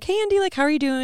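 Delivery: bright, high, chirpy greeting; eager paparazzi. Retold or imitated. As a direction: pitch it up like a keen photographer. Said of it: "He was like".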